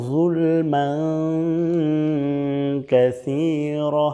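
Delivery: slow, drawn-out Arabic chant; slow Quranic recitation. Acted. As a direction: recite the dua with long held vowels. Full slow recitation of the dua.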